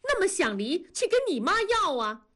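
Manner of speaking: wife snapping at husband over the divorce, shouting high; sudden shout. A narrator's reading. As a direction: suddenly raise the voice high in exasperation. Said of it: "文丽突然提高了声音喊".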